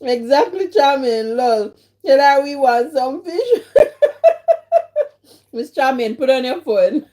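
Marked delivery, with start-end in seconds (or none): 3.7-5.1 very high burst of laughter; real squealing laugh; shrieking laugh. Real, not acted. Laughing at a chat comment.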